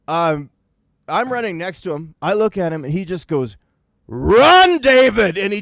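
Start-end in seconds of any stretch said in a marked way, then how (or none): 4.1-5.4 high, loud shout of a name; yelled command. Retold or imitated. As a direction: yell it as you break into a sprint. Friend yells while sprinting.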